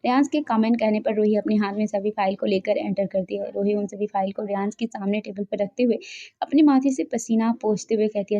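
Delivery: ordinary delivery, nothing marked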